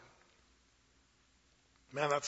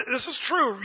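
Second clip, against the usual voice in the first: usual speaking voice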